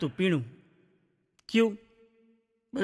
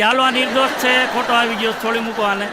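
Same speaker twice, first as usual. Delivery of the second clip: booming, raised voice declaring innocence; judge's loud verdict; 4 semitones higher, 6 dB louder than usual. Acted. Judge loudly ordering the man set free, raised booming voice. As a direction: call it out loud like a judge on the bench.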